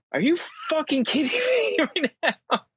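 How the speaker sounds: very high, squealed disbelief; incredulous, high-pitched comic outburst; mock outrage. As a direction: act out exasperation, cracking up midway. Retelling outrage at sleeping wife.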